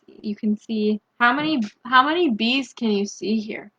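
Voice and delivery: plain reading voice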